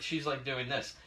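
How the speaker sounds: normal talking voice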